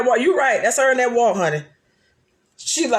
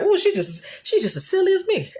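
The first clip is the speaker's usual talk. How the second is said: delighted; 11 semitones higher than usual. Retold or imitated. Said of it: "And I was like".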